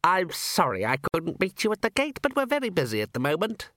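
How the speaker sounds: put-on high, apologetic old official; fussy old herald. Herald apologising to his visitor, high fussy voice. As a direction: play a busy little herald, pitched high.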